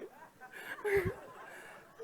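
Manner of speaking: high airy laugh; breathless laugh. Real, not acted. Laughing at the support punch story, a breathless laugh. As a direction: let the laugh spill out.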